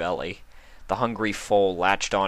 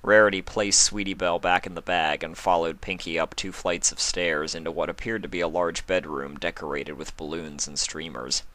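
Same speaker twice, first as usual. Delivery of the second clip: ordinary delivery, nothing marked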